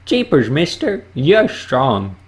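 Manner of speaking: put-on high, eager gee-whiz kid voice; wide-eyed excited kid voice; wholesome 1950s kid. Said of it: "That was Goofy saying a line from Hercules".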